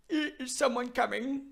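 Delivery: high voice with a stammered start; high timid voice. Acted. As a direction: try out a high-pitched, faltering call. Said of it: "that's not the name we wanna give Arvel".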